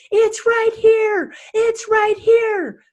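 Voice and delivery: high, loud and slow, desperate insistence; distraught teen girl. Retold or imitated. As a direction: plead like a shaken girl pointing at proof. Distraught girl voiced in story.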